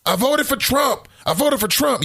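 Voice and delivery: high-pitched mock Trump voter; mocking imitation. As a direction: parrot a boastful voter in a squeaky mocking voice. Mimicking boastful Trump voters.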